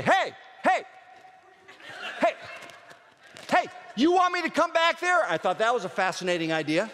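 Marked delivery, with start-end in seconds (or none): 0.0-5.2 high, loud fatherly yell; dad yelling from the front seat. Retold or imitated. Dad yelling at fighting kids in the back seat, loud. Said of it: "My dad would say".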